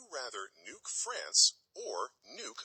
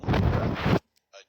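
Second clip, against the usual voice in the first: slow announcer voice; 11 dB louder than usual. Acted.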